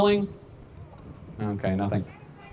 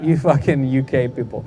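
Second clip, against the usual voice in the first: ordinary delivery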